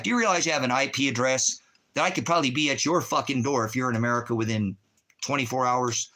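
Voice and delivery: normal talking voice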